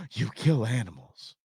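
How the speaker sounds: gasp, then a hushed accusation; mock shock. Critic discovers someone hunts, in mock horror. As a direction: imitate a scandalized critic, lightly hammed-up.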